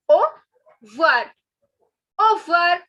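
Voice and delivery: au revoir as oh-vwar, loud and firm; skipped syllable. Shown as a demonstration. Teacher drilling the shortened au revoir, loud clear syllables. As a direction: drop the re from "au revoir". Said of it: "skip pronouncing this".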